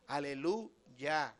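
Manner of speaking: Aleluya stretched out very slowly, syllable by syllable; slow praise word. Shown as a demonstration. Preacher savours the word for the congregation, slowly drawn out. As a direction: let Aleluya unfold slowly, like worship. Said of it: "notamos que es exaltación, adoración allá".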